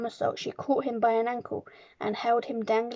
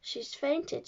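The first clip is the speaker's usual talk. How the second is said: higher but hushed alarm; crying out; 5 semitones higher, 5 dB quieter than usual. A narrator's reading. Miss Honey kneels by giantess, softly crying out. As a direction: report the collapse, no shouting. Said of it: "she cried".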